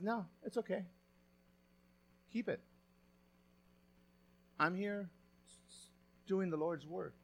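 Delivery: quiet sincere prophet; gentle Elisha. Retold or imitated. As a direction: kindly turn down the gift. Elisha gently refusing the reward.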